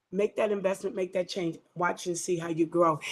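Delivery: usual speaking voice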